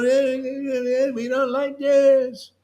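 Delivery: high, whiny mock grumble of naysayers. Preacher mocks doubters in a whiny high voice. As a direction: whine it like a sour skeptic. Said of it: "and they go".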